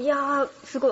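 normal talking voice

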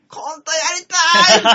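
high, loud, drawn-out cry of longing; retold shout. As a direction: yell it like a desperate wish. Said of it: "って言いましたもんね". (「コントやりたい！」と高く大きく叫ぶ)

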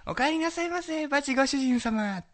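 high, slow, sweet maid welcome; sugary maid cafe greeting. Retold or imitated. Imitating maid's greeting.

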